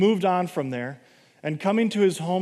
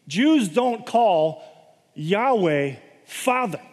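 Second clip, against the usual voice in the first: slow emphasis; 3 semitones higher, much slower than usual. Real, not acted. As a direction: spell the point out word by word. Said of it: "preacher emphasising a point".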